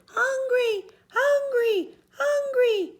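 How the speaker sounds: put-on very high baby wail; a giant faking a hungry baby; hollering baby. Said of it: "The baby began to holler too".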